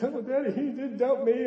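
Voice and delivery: a hint of a weepy daughter; mock tearful daughter. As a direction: imitate a teen whimpering to her dad, hammed-up. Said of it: "she got tears streaming down".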